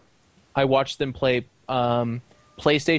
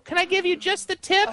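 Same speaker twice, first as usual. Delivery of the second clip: squeaky cartoon princess mockery; Lumpy Space Princess voice. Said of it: "Was that the Lumpy Space Princess voice?".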